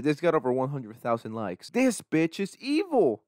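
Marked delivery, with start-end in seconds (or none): 1.7-3.2 high, slow sinister voice; shocked reactor doing a high, slow evil voice; mock villain voice. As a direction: drag it out like a cartoon villain.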